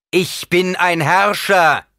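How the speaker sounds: loud, high, slowly drawn-out assertion; imperious shout. A narrator's reading. Emperor asserting himself against Paul, imperious shout. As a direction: proclaim rank at full voice.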